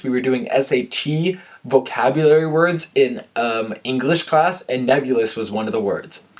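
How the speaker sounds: ordinary delivery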